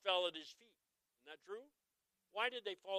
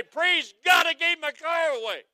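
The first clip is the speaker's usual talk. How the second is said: high, loud hammed-up praise shout; loud mock praise, pitched high; mock church shout; 11 semitones higher, 14 dB louder than usual. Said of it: "I didn't get up in church and say".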